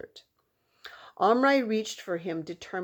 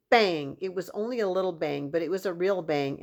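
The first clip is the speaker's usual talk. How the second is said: usual speaking voice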